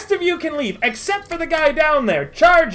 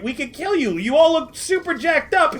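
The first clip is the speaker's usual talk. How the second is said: ordinary talk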